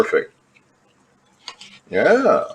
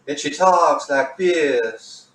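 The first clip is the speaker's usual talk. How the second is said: slow, softer put-on voice of an eerie TV villain; imitating a demonic woman; 2 semitones higher, 4 dB quieter, much slower than usual. Introducing the Walking Dead woman, slow eerie imitation. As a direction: ease into the creepy character voice. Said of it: "woman in this Walking Dead show".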